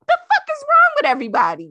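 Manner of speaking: outraged at a billionaire's space trip, shouting; exasperated shout. Real, not acted. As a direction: throw it out in disbelief. Ranting about space trip.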